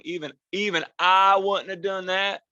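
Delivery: mock a Southern yokel, loud and high; dumb Southerner accent. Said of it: "when I do an accent".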